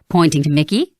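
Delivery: usual speaking voice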